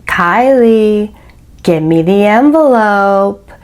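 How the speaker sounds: slow, drawn-out, deliberate order; measured, insistent command with long pauses; retold insistent demand. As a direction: stretch out each word to press the demand. Retold man repeats demand insistently.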